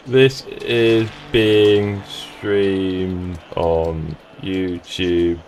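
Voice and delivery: very slow, low, word by word; typing aloud. Real, not acted. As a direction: drag each word out as you type it. Typing a message while saying it.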